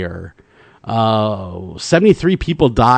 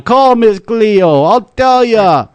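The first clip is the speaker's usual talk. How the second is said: high, slow, mock Jamaican accent; imitating a Jamaican psychic, slowly; 8 semitones higher, 4 dB louder, much slower than usual. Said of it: "however her Jamaican accent went".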